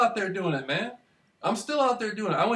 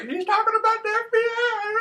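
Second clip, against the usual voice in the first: much higher, put-on squeaky voice over the mic; mock announcer voice; 3 dB louder than usual. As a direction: squeak it out like someone tattling on the microphone. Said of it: "He's like".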